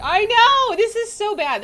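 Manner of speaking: high, loud exclaim; excited outburst. Real, not acted. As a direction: burst out in dismay.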